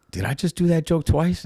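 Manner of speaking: high, startled realization; surprised self-question. Retold or imitated. Drunk comic onstage realizing he repeated a joke, high startled. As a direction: catch yourself in disbelief. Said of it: "And I went".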